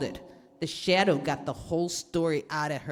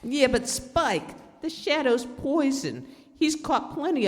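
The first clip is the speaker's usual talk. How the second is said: scared pleading; 9 semitones higher than usual. Acted. Frightened crook pleads with boss.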